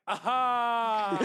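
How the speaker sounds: loud, high forced "aha"; fake customer-service laugh. Coworker mocking his colleague's laugh for customers, fake laugh. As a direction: burst out a big phony laugh. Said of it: "customer service laugh is the worst fake laugh".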